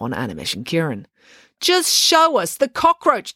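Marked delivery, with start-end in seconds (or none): 1.6-3.3 higher, slowly insistent demand. Acted. April demanding the boys show the cockroach. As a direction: press them, drawn out and pointed. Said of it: "demanded April".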